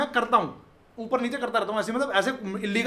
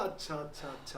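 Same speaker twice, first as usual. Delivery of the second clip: much lower and softer, giving up; resigned mutter; 7 semitones lower, 16 dB quieter than usual. Retold or imitated. Passenger giving in to the police, low resigned mutter. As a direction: swallow the anger and mumble.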